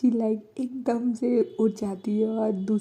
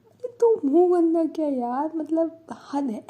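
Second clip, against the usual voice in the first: exasperated teasing; 8 semitones higher, 6 dB louder than usual. Retold or imitated. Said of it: "तो Prachi like".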